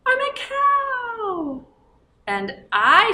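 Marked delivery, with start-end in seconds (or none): 0.1-1.6 high, slow puppet cow voice. Acted. Teacher voices the cow puppet helper for the children. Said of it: "our story time helper".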